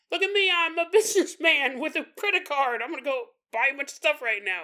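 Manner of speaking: high put-on crook voice with a laugh; bragging thief. Wallet thief boasts about spending, high and gleeful. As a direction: brag like a cartoon rich man.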